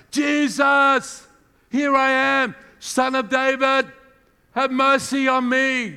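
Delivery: loud, slow, high pleading cry; shouting again. Retold or imitated. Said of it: "Nobody's gonna shut this guy up".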